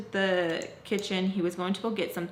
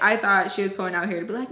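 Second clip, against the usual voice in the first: ordinary delivery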